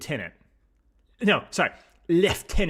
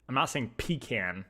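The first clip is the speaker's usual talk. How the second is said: normal talking voice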